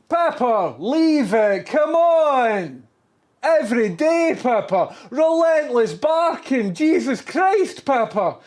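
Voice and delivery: fed up, yell at the dog to stop barking; exasperated yelling. Real, not acted. Yelling at Pepper to quit barking, exasperated.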